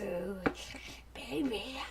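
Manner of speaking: soft whispered come-on; seductive whisper. Pretend. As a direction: breathe the word like a mock seducer. Mock seductive reply.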